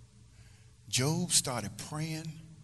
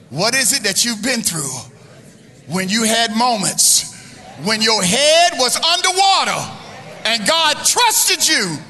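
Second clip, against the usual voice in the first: building from loud to a soaring, much higher, slow cry; preacher crying out about head underwater moments; climactic preaching shout; 8 semitones higher, 12 dB louder than usual. Acted. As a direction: hold the big words up high. Climactic shouted question, rising.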